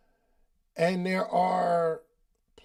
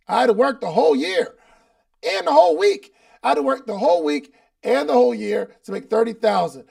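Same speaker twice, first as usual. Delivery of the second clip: climbing higher and louder in disbelief; indignant rising outburst; 4 semitones higher, 6 dB louder than usual. Real, not acted. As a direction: voice rising to a loud, high, exasperated peak. Indignant at low pay.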